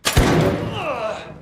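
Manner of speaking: shocked burst of high laughter; sudden high laugh. Real, not acted.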